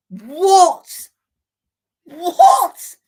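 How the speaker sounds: real outrage at the call; shocked shout. Real, not acted. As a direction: yell it in total disbelief. Penalty confirmed.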